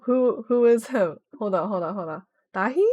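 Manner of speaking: ordinary delivery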